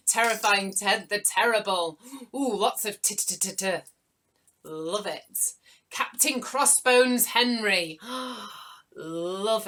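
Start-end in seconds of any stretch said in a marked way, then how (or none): 0.1-2.0 loud, playful pirate proclamation. Pretend. Host reads out a viewer's name, mock pirate call. 5.9-8.0 high, loud, slow pirate proclamation. Pretend. Host proclaims a viewer's captain name, loud mock pirate.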